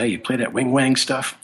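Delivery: put-on phone prank in a Southern accent. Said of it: "put up his best Southern accent".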